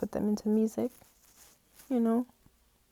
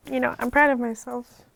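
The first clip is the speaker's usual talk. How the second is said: proud but choking up; real tears, voice breaking; tearful; 5 semitones higher, 4 dB louder than usual. Real, not acted. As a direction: voice catching with tears. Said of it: "I'm crying on camera".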